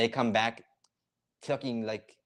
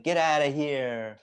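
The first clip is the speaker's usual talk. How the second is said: mock angry; 5 semitones higher, 6 dB louder, slower than usual.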